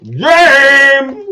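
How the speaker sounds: loud high call of a name. Real, not acted. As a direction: call the name across the room. Calling out the winner.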